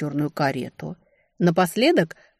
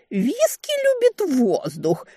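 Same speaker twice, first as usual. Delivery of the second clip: higher, slow whiny mimicry; mocking imitation; 9 semitones higher, slower than usual. A narrator's reading. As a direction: parrot him in a silly voice. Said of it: "передразнила она".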